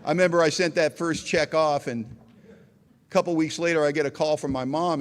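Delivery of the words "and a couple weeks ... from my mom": normal talking voice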